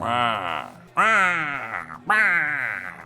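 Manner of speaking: rising monster grunts, Karloff era; Frankenstein's monster. Pretend. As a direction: bellow like the old film monster. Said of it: "the Boris Karloff era".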